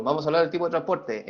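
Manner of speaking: ordinary talk, nothing marked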